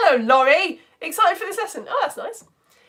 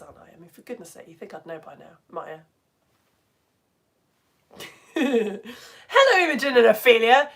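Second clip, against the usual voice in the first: normal talking voice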